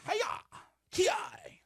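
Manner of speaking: sharp high martial arts cry; karate shout. Shown as a demonstration. Shouting a kiai to mock the chop. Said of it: "And then karate chop".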